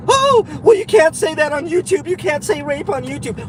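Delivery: high-pitched mock scolding voice; mocking objector. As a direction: put on a fussy high voice to ridicule critics. Mocks imagined objectors to his wording.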